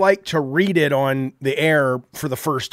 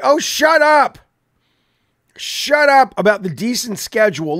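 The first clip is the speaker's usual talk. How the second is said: loud, higher brush-off; snapping at chat; 6 semitones higher, 5 dB louder than usual. Real, not acted. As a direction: shut the commenter down hard. Snapping back at chat comment.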